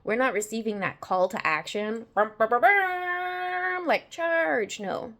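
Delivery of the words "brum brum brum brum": high, slow engine brum; revving brum sound. Acted. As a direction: rev it like an engine. Mimics a charging engine.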